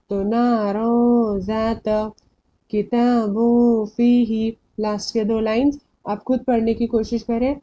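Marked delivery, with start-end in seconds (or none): 0.2-4.6 नारा and किताबुन drawn out, final noon as nasal hum; ikhfa recitation. Shown as a demonstration. Teacher reciting the examples, noon hidden in nose. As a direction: slowly recite नारा ज़ात, किताबुन फीही with nasal holds. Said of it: "इक्फा में से आता है".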